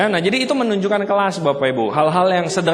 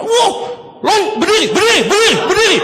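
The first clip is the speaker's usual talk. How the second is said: loud high shouting berdiri; mock MC shout; 11 semitones higher, 5 dB louder than usual. MC ordering the seminar audience to stand, loud mock shout. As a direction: bark the crowd up to their feet.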